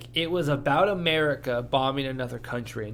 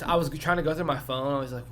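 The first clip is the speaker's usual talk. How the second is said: ordinary talk, nothing marked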